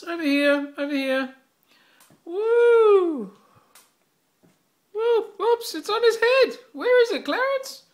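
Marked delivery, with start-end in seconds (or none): 2.2-7.8 high, loud excited calls; calling from far away. Real, not acted. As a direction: call out, thrilled. Excited calling as cat leaps.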